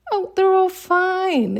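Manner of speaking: the universe sings back that all is well. Pretend. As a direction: reassure her like a cheerful cosmic voice. The universe answering her worry.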